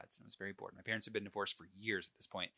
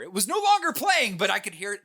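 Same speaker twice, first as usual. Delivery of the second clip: much higher, louder rush; excited burst; 10 semitones higher, 12 dB louder than usual. Real, not acted. Telling cohosts the song stuck in his head, excited. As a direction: blurt it out, thrilled.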